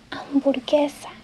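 "hamburguesa" said slowly the Spanish way; Spanish word. Shown as a demonstration. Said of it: "es casi igual en español".

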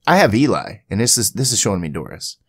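usual speaking voice

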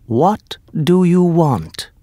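slow, high grand genie; voiced genie. A narrator's reading. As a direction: ask like a magic servant.

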